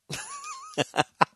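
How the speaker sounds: high-pitched real laugh. Real, not acted. Telling his Mufasa interview story, laughing. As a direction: crack up at your own answer. Cracks up at own Mufasa answer.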